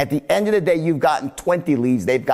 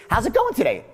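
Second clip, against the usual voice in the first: GOING lifted high; higher "How's it going today?", keen to know; caring uptone greeting; 6 semitones higher, faster than usual. Shown as a demonstration. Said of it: "then I hit them with this".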